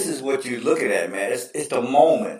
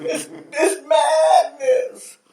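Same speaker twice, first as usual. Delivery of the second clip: Vitale impression; 11 semitones higher than usual. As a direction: savor every word like a hyped announcer. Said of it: "he's like".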